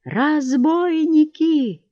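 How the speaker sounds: high, long-drawn cry of alarm; wailed alarm. A narrator's reading. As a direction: wail it out slowly, high-pitched. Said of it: "завопил неожиданно купец".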